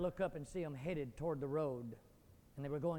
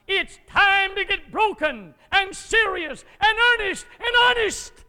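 much higher, much louder frantic shout; screaming at a child; 14 semitones higher, 20 dB louder, slower than usual. Acted. Father screaming at daughter Brenda heading for the road, desperate shout. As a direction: yell to stop her before the road. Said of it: "I don't scream and say".

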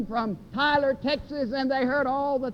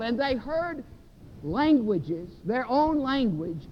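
ordinary talk

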